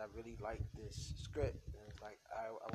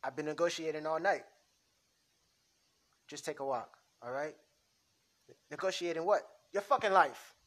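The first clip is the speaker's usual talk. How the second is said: building from firm to higher and louder shouting; mob fight; 7 semitones higher, 18 dB louder than usual. Acted. Mobster to partner over the hit, building angry shout. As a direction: snap back harder each line. Script argument flares.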